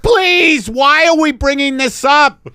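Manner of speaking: high, loud objection; exasperated photographer. Acted. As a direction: plead to stay on task.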